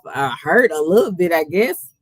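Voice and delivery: usual speaking voice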